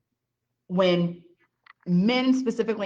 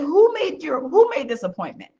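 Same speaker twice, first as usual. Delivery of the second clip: a pointed, raised demand; sharp, pitched-up challenge; demanding question; 8 semitones higher, 5 dB louder than usual. Retold or imitated. She grills the unprepared salesman, pointed and raised. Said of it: "I said".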